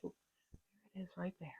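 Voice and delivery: very soft, slow, unvoiced whisper; whispered aside while showing the scarf. Real, not acted. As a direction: barely breathe the words out. Pointing out the scarf.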